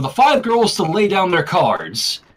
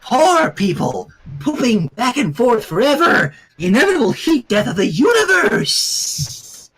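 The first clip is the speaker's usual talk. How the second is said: eerie; 5 semitones higher than usual. A narrator's reading. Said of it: "casting an eerie glow".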